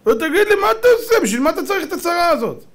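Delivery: high-pitched incredulous demand; exasperated questioning. Acted. Townsman asks the governor why bother, exasperated. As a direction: press him in a high, exasperated voice.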